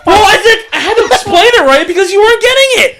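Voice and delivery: real high, loud outburst through laughter; laughing exasperated protest. Real, not acted. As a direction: defend yourself loudly, half laughing, exasperated. Laughing, exasperated defence of explanation.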